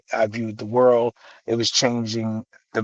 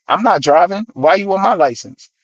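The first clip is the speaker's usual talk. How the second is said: indignant protest; 6 semitones higher, 3 dB louder than usual. Retold or imitated. Retold argument with police over license.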